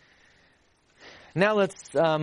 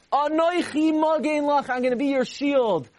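fervent proclamation; 10 semitones higher than usual. Acted. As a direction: proclaim it up high. Preaching God's promise.

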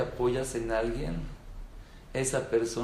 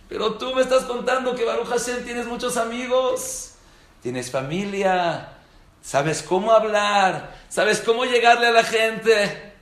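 higher, louder, slowing on "sabes cómo hablar"; reproachful retort; 9 semitones higher, 8 dB louder than usual. Retold or imitated. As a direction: point out his resources. El donante reprocha. (reproche del donante, más alto y fuerte)

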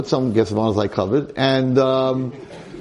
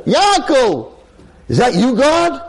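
calling as God; 13 semitones higher, 3 dB louder, slower than usual. Acted. God calls, Yankel answers.

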